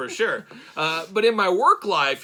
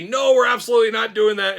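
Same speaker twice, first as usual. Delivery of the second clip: loud, raised-pitch retold objection; adamant refusal; 5 semitones higher, 8 dB louder than usual. Dad retelling how he vetoes a plan, adamant. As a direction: put your foot down, loudly.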